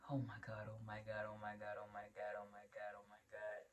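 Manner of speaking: low, very soft repeated mutter; imitating overwhelmed self-talk; panicked muttering. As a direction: chant it under your breath on one low note. Retold panic muttering while running around.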